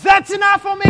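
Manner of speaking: loud, high outraged shout; enraged high priest. Acted. Caiaphas condemning Jesus, shouting in outrage. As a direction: bellow it in fury. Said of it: "so he says".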